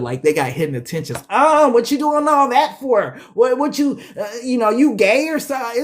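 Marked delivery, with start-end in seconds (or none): 1.3-5.8 high, loud mock suspicious woman. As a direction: play her side-eyeing the nice guy, loud and high. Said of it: "women act weird when you treat them good".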